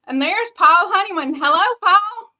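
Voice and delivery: excited greeting. Real, not acted. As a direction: call out a happy welcome. Greeting a live viewer.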